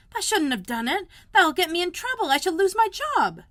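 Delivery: high, loud panicky near-tears; half crying. A narrator's reading. Martha fearing she will lose her job, half crying. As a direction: fret aloud about trouble. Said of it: "half crying".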